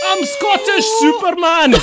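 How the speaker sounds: Billy Connolly-style brag through giggles; mock Scottish god proclaiming himself; Scottish Icarus. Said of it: "doing a Billy Connolly".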